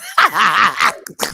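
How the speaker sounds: loud crying laugh; shrieking laugh. Real, not acted. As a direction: burst into a howling laugh. Shrieking laugh in retold scene.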